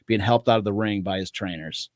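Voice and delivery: measured scene narration, phrases falling; formal, even-paced stage-direction read; screenplay read-aloud. Acted. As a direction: narrate the action from the script.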